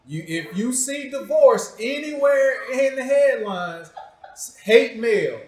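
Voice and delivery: rally the crowd against her, half joking; joking warning. Real, not acted. Host warning the audience to send hate mail if she ever leaves, loud laughing mock threat.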